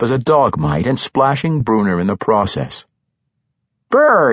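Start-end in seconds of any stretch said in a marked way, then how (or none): 3.9-4.3 high, loud shivering brr; shivering brr after a dunk in water. Acted. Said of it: "feeling a bit chilly".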